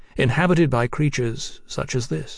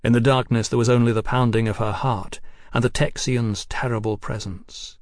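ordinary delivery, nothing marked

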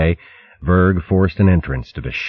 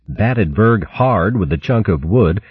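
usual speaking voice

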